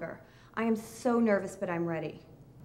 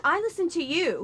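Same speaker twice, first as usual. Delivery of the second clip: angry retort; 6 semitones higher, 6 dB louder than usual. Acted. Couple arguing.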